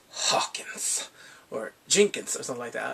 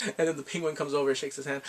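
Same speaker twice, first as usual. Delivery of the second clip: ordinary talk, nothing marked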